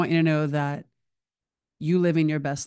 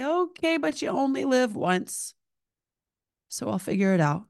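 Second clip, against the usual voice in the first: much higher, upbeat persuading friend; bright high-pitched friend urging her to go; cheerful coaxing; 8 semitones higher than usual. Retold or imitated. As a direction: sell it like you only live once. Friend talking her into going.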